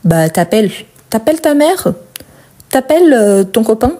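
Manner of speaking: "t'appelles" run together, the u of "tu" dropped; casual everyday French, "tu appelles" shortened to "t'appelles"; spoken elision. Shown as a demonstration. Teacher gives learners examples of everyday speech, spoken elision. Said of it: "c'est ce qu'on appelle délision quand on parle".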